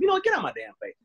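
playful fake outrage at the smell; mock annoyed snap. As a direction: tease the partner like you're fed up with him. Acting annoyed partner.

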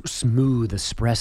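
low, slow seductive voice; a hint of a sultry ad voice; sexy voice. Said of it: "do it in a sexy voice".

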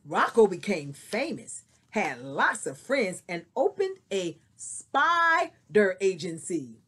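lively storybook reading; story narration. Acted. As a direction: read it like a picture book to kids. Reads a picture book aloud.

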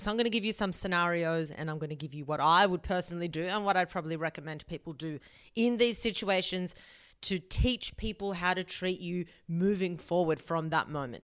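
usual speaking voice

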